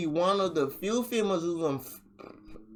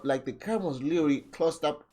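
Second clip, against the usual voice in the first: ordinary talk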